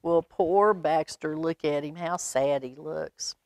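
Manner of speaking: usual speaking voice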